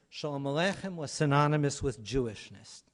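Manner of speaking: normal talking voice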